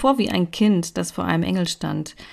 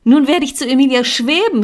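rising higher and louder in delight; joyful exclamation; 6 semitones higher, 5 dB louder than usual. A narrator's reading. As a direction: call it out, overjoyed. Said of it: "rief ich".

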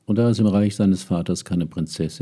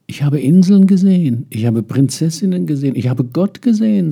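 much higher and louder, accusing; reproachful outburst; 10 semitones higher, 9 dB louder than usual. Acted. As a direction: throw it at his father like a charge. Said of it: "sagte der Prinz vorwurfsvoll".

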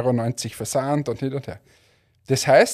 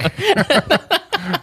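high laugh; 3 dB louder than usual. Real, not acted. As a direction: burst of high-pitched laughter. Laughing at the hotline bit.

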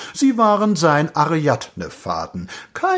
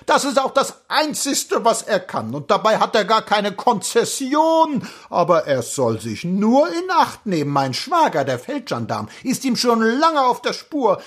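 high-pitched, worked-up grumbling; indignant tirade; 10 semitones higher than usual. A narrator's reading. Cloakroom woman complaining about Anton to the prince, indignant tirade. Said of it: "beruhigte sich nicht eher".

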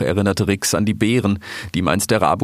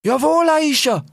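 eager shout. Acted. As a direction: shout it back like eager soldiers. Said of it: "riefen sie".

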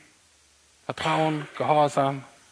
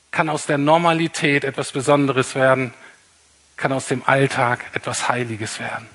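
normal talking voice

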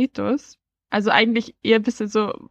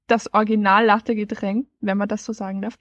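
ordinary talk, nothing marked

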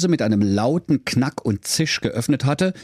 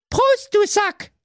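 very high slow squeaky reply; reflex toast; 3 dB louder, slower than usual. Acted. Sister answering the toast, very high squeaky voice. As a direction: blurt it back automatically. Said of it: "antwortete reflexartig".

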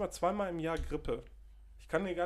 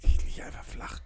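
croaky voice; 7 dB louder than usual. Retold or imitated. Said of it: "mit krächzender Stimme".

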